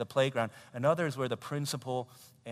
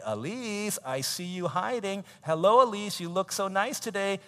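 high, coaxing greeting; imitated school lady's greeting; cheery teacher; 7 semitones higher, 5 dB louder than usual. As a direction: fuss sweetly over a little child. Acting lady greeting hiding child.

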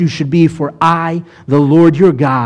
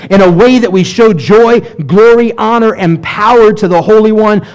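fervent preaching; 4 semitones higher than usual. Acted. Preaching praise of God.

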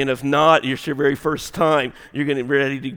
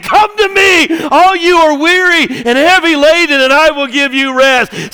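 preaching Jesus' words; 13 semitones higher, 13 dB louder than usual. Acted. Preacher voicing Jesus inviting the weary, loud proclamation. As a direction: call it out over the crowd. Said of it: "and he's saying".

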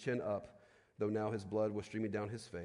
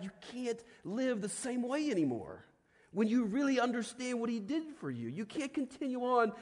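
higher, impassioned sermon voice; full-force preaching; 9 semitones higher than usual. Acted. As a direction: drive the point home to the congregation.

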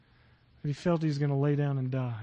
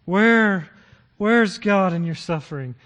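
ordinary talk, nothing marked

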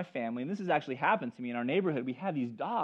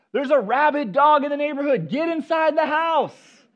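high, loud alarm, last words slow; urgent warning shout; 8 semitones higher, 8 dB louder than usual. Retold or imitated. Man shouting to the neighborhood about a rabid dog, high and loud. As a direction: call out the danger to the street.

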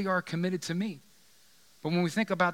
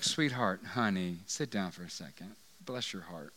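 gentle Jesus voice; 7 semitones lower, 5 dB quieter than usual. Retold or imitated. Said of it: "That's a Southern term for".

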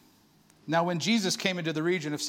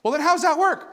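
higher, louder baffled question; puzzled friend; 9 semitones higher, 7 dB louder than usual. Retold or imitated. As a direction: press him like confused Sam. Sam presses the question.

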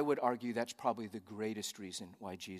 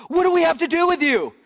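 very high, loud mock shriek; demons calling out; 16 dB louder than usual. Preacher as demons confronting Jesus in the temple, shrieked challenge. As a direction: screech it out like a taunting demon, breaking into stammer. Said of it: "they're calling him out".

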